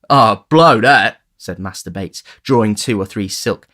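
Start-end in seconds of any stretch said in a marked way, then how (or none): 0.1-1.2 loud, slow contemptuous scoff; scornful dismissal. A narrator's reading. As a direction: scoff it away loudly. Charlie dismissing the idea.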